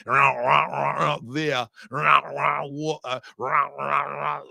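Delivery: rumbling motorcycle take on Australian speech; mock Australian accent. Man teasing Australians about how they talk, mock Australian accent. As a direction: parody Australian as run-together growly "rah rah". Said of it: "you sound like a bunch of motorcycles".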